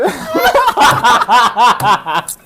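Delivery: loud, high-pitched laughter; big uncontrolled laugh; real laughing fit. Real, not acted. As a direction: burst out in helpless high laughter. Laughing at driver's failed reach.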